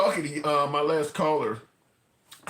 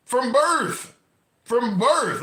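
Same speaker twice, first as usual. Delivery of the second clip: higher and louder, hammering the words home; emphatic outburst; 6 semitones higher, 5 dB louder, much slower than usual. Real, not acted. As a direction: pound it twice, indignant. Outraged point about slavery.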